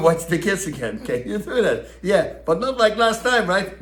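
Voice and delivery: a touch of a wary wife holding him to it; wife's warning. Retold or imitated. Wife warns before he babysits.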